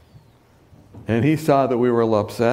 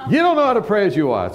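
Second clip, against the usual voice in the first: smug rebuke; 8 semitones higher, faster than usual. Retold or imitated. Tony lectures the speaker on prayer, smug and quick. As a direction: snap it back smugly, higher and quicker.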